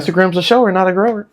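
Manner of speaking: high-pitched playful interjection; teasing quip. Real, not acted. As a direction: pipe up high with a cheeky joke. Teasing wordplay after an accidental innuendo.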